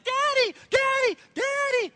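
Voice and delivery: put-on very high, loud child's screech; daughter yelling. Loud daughter calling to her dad, imitated high-pitched yell. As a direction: yell like a small kid demanding attention. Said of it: "almost as if my daughter is yelling".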